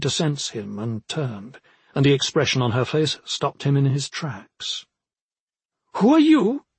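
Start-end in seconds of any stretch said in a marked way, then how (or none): 6.0-6.6 high, loud challenge; commanding, high-pitched question; demanding. A narrator's reading. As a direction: demand an answer, pitched sharply up. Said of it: "she demanded in a tone of voice that suggested he ought to answer".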